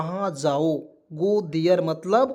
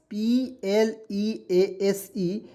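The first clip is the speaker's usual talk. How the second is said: slow spelling; much slower than usual. Shown as a demonstration. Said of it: "teacher spells out PLEASE".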